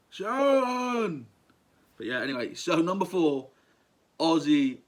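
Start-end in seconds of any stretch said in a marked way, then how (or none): 0.1-1.3 high, loud, wailing call in Brummie; imitation Birmingham yell, pitched way up. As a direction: holler it drawn out like a befuddled Brummie rocker. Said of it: "My best Aussie impression's not good at all".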